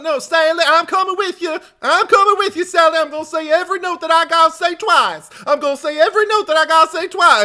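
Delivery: high, loud mocking Southern twang for Franklin; hammed-up Southern whine, repeating everything; thick Southern accent. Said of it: "a thick Southern accent".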